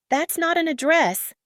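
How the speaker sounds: panicky protest. A narrator's reading. Frightened driver protests to gunman passenger, panicky. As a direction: squeak it out in fear.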